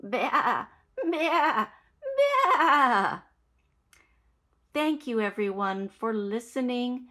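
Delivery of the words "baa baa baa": bleats rising high and loud through a laugh; laughing sheep baas. Shown as a demonstration. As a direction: giggle your way through the baas. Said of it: "This is how the sheep sounds".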